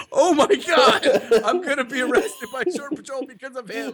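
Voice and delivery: high, laughing mock panic. Laughing while retelling fear of arrest by Shore Patrol. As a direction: squeal it out through laughter. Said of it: "I'm like".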